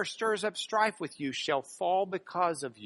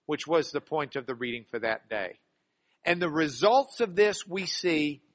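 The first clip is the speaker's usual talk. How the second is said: ordinary talk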